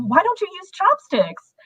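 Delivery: high-pitched mimicry of naive surprise; mock clueless questioner. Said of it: "if I had enough people, you know, saying".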